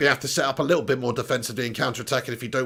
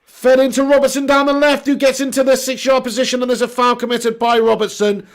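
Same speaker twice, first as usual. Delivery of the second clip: high, raised football commentary easing off; live play-by-play; 7 semitones higher, 5 dB louder than usual. Real, not acted. Commentator following the ball down the left to a foul, live play-by-play. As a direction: call the move as it unfolds.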